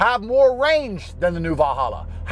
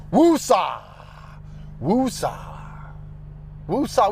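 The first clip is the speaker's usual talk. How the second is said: slow, drawn-out calming woosah, breathing out the anger; calming himself down; much slower than usual. Real, not acted. Driver annoyed at his car, calming himself with woosah. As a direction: talk yourself down like a mantra. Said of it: "I'm getting mad. Andrew".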